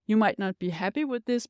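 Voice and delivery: ordinary delivery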